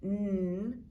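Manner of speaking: N as a held humming "nnn"; isolated letter sound. Shown as a demonstration. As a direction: sustain the sound of N, not the letter name. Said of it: "I hear the N sound".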